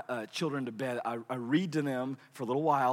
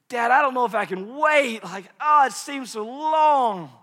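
put-on whining child; 8 semitones higher, 7 dB louder than usual. Son whining he cannot wait.

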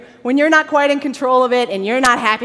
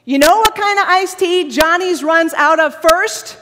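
loud, slow, indignant complaint; mock grievance rant; 5 semitones higher, 8 dB louder, slower than usual. Preacher confessing his iced tea murmuring, loud mock outrage. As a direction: spell out each gripe emphatically.

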